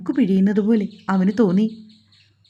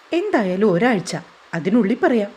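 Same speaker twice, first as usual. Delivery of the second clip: ordinary delivery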